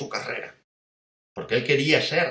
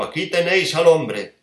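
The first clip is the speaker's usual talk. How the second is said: proclaiming loudly; 4 semitones higher, 6 dB louder than usual. Acted. Pilato presents Jesus.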